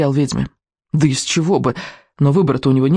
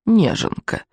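scornful witch; 5 semitones higher, slower than usual. A narrator's reading. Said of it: "сказала ведьма".

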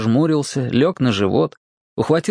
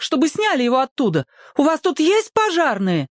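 high alarmed woman's voice; mother scared for her son; frightened mother. A narrator's reading. Panicked mother questions. (испуганный высокий женский голос)

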